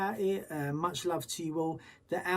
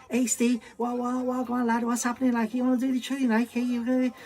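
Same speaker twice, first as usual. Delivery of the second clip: loud, much higher Scouse impression; hammed-up Liverpool lad, giddy and loud; imitating a Scouse mate calling excitedly; Scouse accent; 8 semitones higher, 6 dB louder than usual. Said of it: "I'm fluent Scouse".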